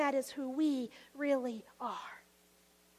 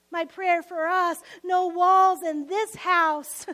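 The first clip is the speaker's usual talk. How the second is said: louder, slower, stressing walls; fervent declaration; 8 dB louder, slower than usual. Real, not acted. Preacher proclaiming his prayer for the church, loud and slow. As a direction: proclaim it with full conviction.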